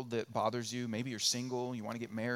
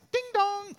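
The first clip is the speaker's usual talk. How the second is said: very high sung ding dong of a doorbell; mock doorbell chime; 6 dB louder than usual. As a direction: chime it like a delivery at the door. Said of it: "I want click".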